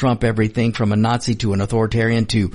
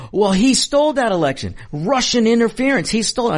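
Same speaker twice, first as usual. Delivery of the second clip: mock indignant Democrat; 10 semitones higher than usual. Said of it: "they will say".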